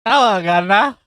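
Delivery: mock British greeting. [name] greets the host in loud mock British.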